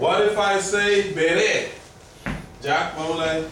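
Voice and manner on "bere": "bere" as be-RE, final syllable stressed; stress on second syllable. Shown as a demonstration. Teacher quizzes Jack on the accent, "bere" stressed at the end. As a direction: lean slowly on the end of "bere". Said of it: "On the second syllable".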